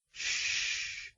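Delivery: whispered, tender hush; soft shush. Acted. Hero soothing the crying heroine, soft shush. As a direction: hush her gently. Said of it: "he crooned".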